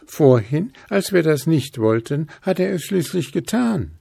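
squeaky little critter; Hüpf. A narrator's reading. Hüpf tells the ghosts what they want, high and small. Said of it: "sagte Hüpf".

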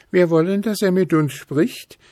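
squeaky little critter; Hüpf. A narrator's reading. Hüpf tells the ghosts what they want, high and small. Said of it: "sagte Hüpf".

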